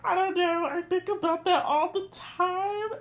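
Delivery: high, loud mock-crying voice; imitated tearful wail. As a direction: wail it as your tearful past self. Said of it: "in tears".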